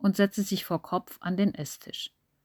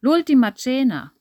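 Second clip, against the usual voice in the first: loud Italian call on entering; 6 semitones higher, 7 dB louder than usual. Acted. Massimo calls out loudly to the family as he enters. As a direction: announce it grandly, louder and higher. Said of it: "rief er, als er die Wohnung betrat".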